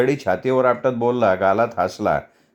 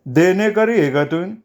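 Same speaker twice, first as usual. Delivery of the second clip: teasing laugh; 6 semitones higher than usual. A narrator's reading. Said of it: "मान उंचावत हसत विचारलं".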